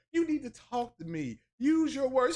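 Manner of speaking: lightly voiced complaining woman; mock nagging woman. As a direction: nag like an upset partner. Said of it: "You know how women are".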